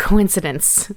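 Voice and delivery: usual speaking voice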